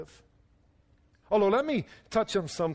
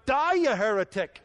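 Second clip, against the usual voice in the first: higher, moderately raised joke punchline; hammed-up condemnation called downward; mock shout; 5 semitones higher, 4 dB louder than usual. Rescuer to the man falling from the bridge, mock shout. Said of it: "he shouts down".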